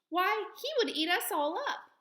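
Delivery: alarmed mouse. Acted. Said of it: "cried the little queen".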